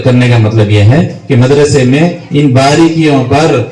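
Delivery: normal talking voice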